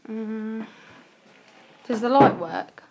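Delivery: ordinary delivery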